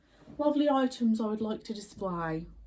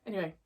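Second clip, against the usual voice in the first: quick gasp; much faster than usual. Real, not acted. Reacting to Stranger Things.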